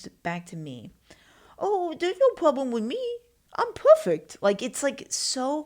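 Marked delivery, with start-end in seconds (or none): 1.6-4.4 put-on very high, loud babyish voice; mock innocence. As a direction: play innocent in an exaggerated squeaky voice. Mocking own denial of faults.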